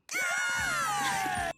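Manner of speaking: high loud burst of laughter. Real, not acted. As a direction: cackle out loud.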